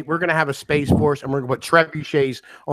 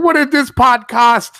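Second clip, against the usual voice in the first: high, loud, drawn-out mock outrage; mock-mad guest; 6 semitones higher, 5 dB louder, slower than usual. Retelling the guest's anger at the stream, mock outrage. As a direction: imitate someone loudly fed up. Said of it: "he got really mad".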